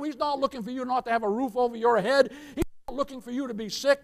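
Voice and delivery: usual speaking voice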